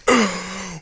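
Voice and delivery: startled gasp of waking; sharp gasp. Retold or imitated. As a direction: gasp like coming to. Coming to after fainting.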